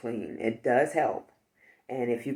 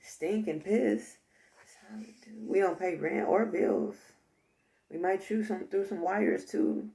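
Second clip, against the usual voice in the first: higher, casual mouse voice; put-upon mice; 5 semitones higher than usual. Pretend. Mice defending how they live rent-free, playfully innocent role voice. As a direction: play a mouse innocently owning up. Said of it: "And the mice like".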